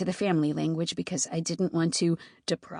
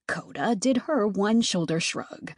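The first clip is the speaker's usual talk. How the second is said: ordinary delivery, nothing marked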